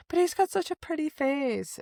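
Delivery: high, put-on cooing voice; playful high-pitched mock fawning; teasing coo. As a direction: mimic her goading him sweetly. Voices heroine teasing about his looks.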